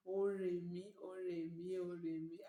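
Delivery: mother-in-law praising, Akure dialect "ó rè mí"; Akure intonation. Retold or imitated. Mother-in-law praising daughter-in-law, Akure dialect "ó rè mí". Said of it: "forgive my intonation".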